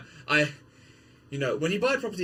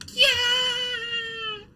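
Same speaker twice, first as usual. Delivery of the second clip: much higher squeal of Gyak; shrill-voiced meme yelp; high squeaky yelp; 4 dB louder than usual. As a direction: screech it like a cartoon bird. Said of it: "Where he's like".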